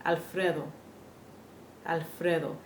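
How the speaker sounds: Alfredo, fre with a short pure e, softly; correct Spanish e. Shown as a demonstration. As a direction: model Alfredo the Spanish way, no ay glide. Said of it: "You hear the difference?".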